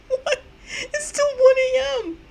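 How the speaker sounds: high, slow laughing disbelief; nervous laugh-cry. Real, not acted. Gamer stunned by the twist, half laughing. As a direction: laugh through the shock.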